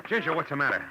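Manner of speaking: urgent call to a frightened woman. Acted. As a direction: call her name across the dark. Calling to upset Ginger.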